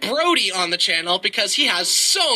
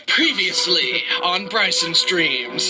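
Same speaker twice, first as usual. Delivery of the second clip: slow, low mock announcer voice, laughing; TV recap announcer; 3 semitones lower, slower than usual. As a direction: intone it like a drama recap.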